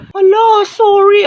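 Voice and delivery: high, loud, slow gasped apology; hammed-up high apology; mock panic. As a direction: squeal out the sorry. Apologizes for forgetting.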